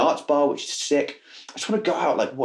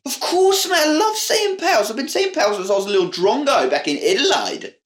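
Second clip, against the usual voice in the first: high-pitched, hammed-up Aussie; excitable cartoon Australian; bad Australian accent; 10 semitones higher than usual. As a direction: gush in an over-the-top Australian twang. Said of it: "is that how Australian people speak".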